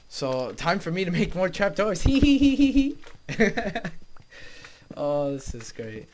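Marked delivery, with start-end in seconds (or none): 2.0-4.9 high, loud, slow villain giggle; mock-evil hee hee laugh; evil cackle. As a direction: cackle like a cartoon schemer. Gleeful about building trapdoors.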